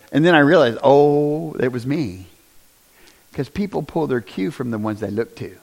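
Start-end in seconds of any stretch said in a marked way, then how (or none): none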